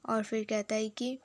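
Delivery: ordinary talk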